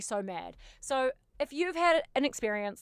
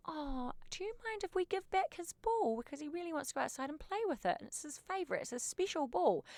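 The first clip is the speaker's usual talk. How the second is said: much higher, soft, coaxing; sweet voice to a toddler; 7 semitones higher, 6 dB quieter than usual. Retold or imitated. Asking a toddler to give back the ball, high and sweet. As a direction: coax a little girl to hand it over. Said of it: "I bend down to this little girl".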